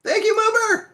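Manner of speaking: high, loud excited call; excited shout. Real, not acted. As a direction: shout the name with glee.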